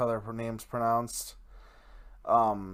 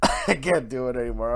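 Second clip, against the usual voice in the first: laughing, louder burst of words; cracking up; 6 dB louder, faster than usual. Real, not acted. Podcaster giving up the impression, really cracking up. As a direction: break character and laugh through it.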